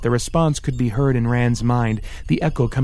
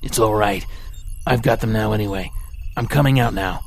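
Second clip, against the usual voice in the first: labored baritone. A narrator's reading. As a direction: sound worn out after the jump. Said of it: "labored baritone voice".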